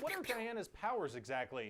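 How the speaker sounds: ordinary delivery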